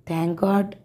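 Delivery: soft, choked with emotion. A narrator's reading. Man holding his frightened beloved close, choked up. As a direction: relief catching in the throat. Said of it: "డగ్గొతికతో అంటూనే".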